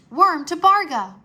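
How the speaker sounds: loud, high command; commanding call. A narrator's reading. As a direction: order the dragon to go. Command to transport.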